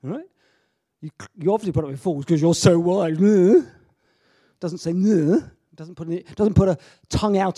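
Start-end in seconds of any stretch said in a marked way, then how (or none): 1.0-3.2 heavy mock praise, a bit louder; sarcastic jab at the church. Shown as a demonstration. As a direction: lay the sarcasm on thick. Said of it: "that's a sarcastic comment".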